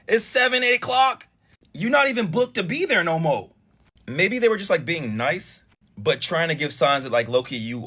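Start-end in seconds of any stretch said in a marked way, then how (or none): 0.1-3.5 exasperated telling-off. Retold or imitated. Host voices imagined telling-off.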